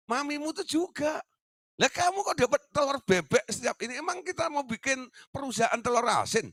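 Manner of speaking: high, loud sarcastic rebuke; mocking scolding. Father mocking his child's zero marks, high sarcastic scolding. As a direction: ridicule the kid for scoring zero.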